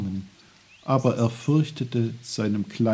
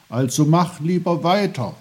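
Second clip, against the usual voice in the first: raised, louder serious advice; earnest urging; 7 semitones higher, 7 dB louder than usual. A narrator's reading. Little uncle sends boy onward, earnest. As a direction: urge firmly and earnestly. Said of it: "fügte er ernst hinzu".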